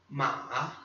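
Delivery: "Ma" in a low dipping third tone. Shown as a demonstration. Teacher showing learners the third tone, "Ma" falling then rising. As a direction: dip "Ma" low, then lift it. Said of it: "terceiro tom".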